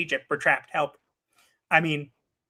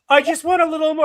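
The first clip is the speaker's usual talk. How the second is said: high whiny plea for more; imitating wife; 9 semitones higher, 4 dB louder than usual. Husband mimicking wife begging for more food, high-pitched. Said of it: "she'll go".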